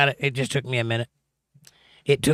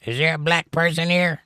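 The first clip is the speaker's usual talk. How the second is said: high-pitched, half-hearted cartoon bartender call; mock yelling out; 7 semitones higher than usual. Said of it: "yelling out".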